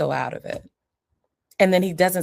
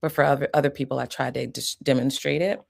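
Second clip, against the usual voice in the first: ordinary talk, nothing marked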